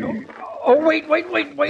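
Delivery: high-pitched, urgent cry to stop; pained panic. Acted. Injured man begging his helper to wait, pained panic. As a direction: cry out as pain shoots through you.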